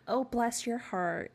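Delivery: high, drawn-out, gushing sweetness; syrupy-sweet mock sympathy; fake sweet. Shown as a demonstration. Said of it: "It's not sweet".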